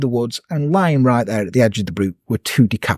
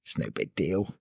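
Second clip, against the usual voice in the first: muttered aside; 9 semitones lower, 12 dB quieter than usual. Retold or imitated. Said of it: "He's like".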